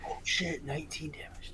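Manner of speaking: hushed whisper under the breath. Real, not acted. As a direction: whisper it in dread. Player reacts to big damage roll.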